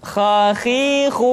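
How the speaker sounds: Khakhihu slow, thick and full in the throat; thick heavy khakhihu. Shown as a demonstration. Teacher showing the correct thick Khakhihu. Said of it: "bauh, tidak khakhihu bah".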